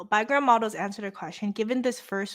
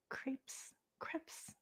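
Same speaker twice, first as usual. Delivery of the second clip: crepes tested very quietly in French style; French accent; 5 semitones higher, 17 dB quieter than usual. Shown as a demonstration. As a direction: try crepes under your breath, the French way. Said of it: "Can somebody correct my French?".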